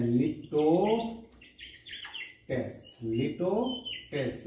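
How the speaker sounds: लिटोटेस drawn out very slowly, syllable by syllable; slow pronunciation demo. Shown as a demonstration. Teacher slowly pronouncing लिटोटेस for students. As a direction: spell out लिटोटेस slowly for the class. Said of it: "जिसे हम क्या बोलते हैं?".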